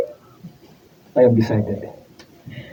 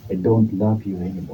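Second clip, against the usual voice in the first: cold quiet; 5 semitones lower, 7 dB quieter than usual. Acted. Husband ends the marriage.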